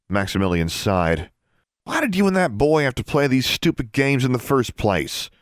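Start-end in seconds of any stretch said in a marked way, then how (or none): 1.8-5.3 high, irritated demand; exasperated scolding. A narrator's reading. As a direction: scold your sister for her games. Brother scolds his sister, exasperated.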